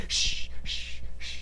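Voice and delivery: soft, repeated shushes; quiet imitation hush. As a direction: shush an unconscious victim, drawn out. Imitates villain hushing knocked-out victim.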